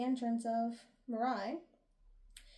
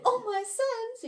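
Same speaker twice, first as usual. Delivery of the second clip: very high, scandalized gasp of a line; hammed-up high-pitched shock; mock pearl-clutching; 11 semitones higher, slower than usual. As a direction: imitate him with theatrical flair. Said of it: "He probably clutched his pearls".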